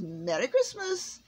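high, stretched-out holiday call; festive farewell. A narrator's reading. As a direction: sing out the greeting slowly. Santa calls his festive farewell.

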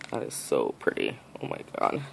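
low, whispered wonder; hushed awe. Real, not acted. Whispering in awe to the camera over tiny nail charms. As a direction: breathe it out like seeing treasure.

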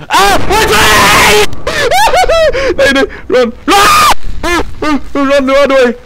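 high, loud panicked shouting with nervous laughs; frantic panic. Real, not acted. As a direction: scream at yourself to run away. Fleeing Slenderman in a panic.

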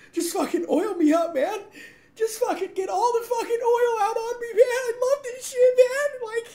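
high squeaky laughing voice; laughing squeal. Pretend.

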